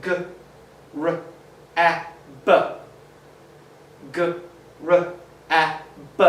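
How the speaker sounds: "g, r, a, b" each sound separate; letter by letter. Shown as a demonstration. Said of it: "Just like in the word g".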